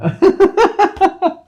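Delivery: high, loud burst of laughter; real laugh. Real, not acted. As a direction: crack up at your own joke. Laughing at own joke.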